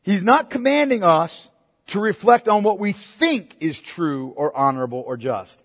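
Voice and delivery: normal talking voice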